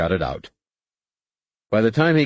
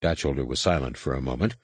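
ordinary delivery, nothing marked

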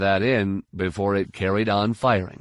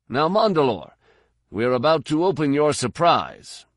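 higher-pitched, swaggering soldier's call; cocky ribbing, pitched up above the narration; brash comrade in battle; 6 semitones higher, 3 dB louder than usual. Acted. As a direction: needle a fellow fighter with loud bravado. Carid's figure voiced in the story.

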